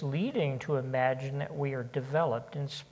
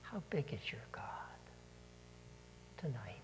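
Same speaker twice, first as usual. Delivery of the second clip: fast whispered question; 2 semitones lower, 13 dB quieter, faster than usual. Real, not acted. Preacher closes the sermon, whispering the question. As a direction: hush it almost to nothing.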